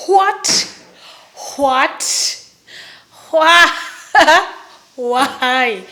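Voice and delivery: loud, explosive disbelief with a burst of laughter; hammed-up incredulous shouting; acted shocked outburst. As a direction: blurt each word out separately, way too loud. Shocked at bottle demand, acted.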